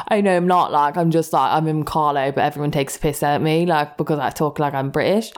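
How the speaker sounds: earnest attempt at an English accent. Catfish insisting he's from Carlo, put-on English accent. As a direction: imitate an Englishman, properly this time. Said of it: "me doing that accent is actually making me laugh".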